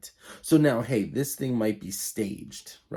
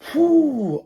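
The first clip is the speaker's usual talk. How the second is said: loud whew; 11 dB louder than usual. Real, not acted. Exhales after retelling the slap.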